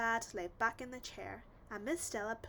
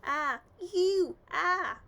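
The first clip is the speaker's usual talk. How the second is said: open-mouthed, louder garbled sounds; mouth open mumble; 8 semitones higher, 11 dB louder, much slower than usual. A narrator's reading. Wolf at the dentist mouth open: garbled mumble. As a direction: speak with your jaw wide open. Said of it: "mumbled Big Bad".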